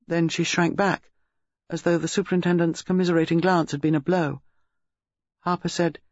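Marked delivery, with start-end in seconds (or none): none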